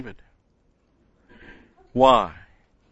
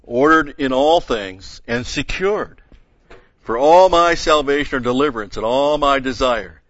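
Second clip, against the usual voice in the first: louder, declaimed Bible verse; scripture reading; 3 semitones higher, 7 dB louder than usual. Acted. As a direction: read it out as a ringing proclamation. Resumes reading scripture aloud.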